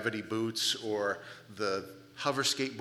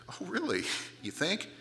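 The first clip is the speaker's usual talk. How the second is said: sarcastic; 6 semitones higher than usual. Real, not acted. Mocking an understatement in the verse.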